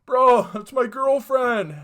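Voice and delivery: very high, very loud, slow shout; full anguished yell. Retold or imitated. Boyfriend staring through the window, yelling it at full force. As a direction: scream it slowly at the top of the voice. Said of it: "He's like".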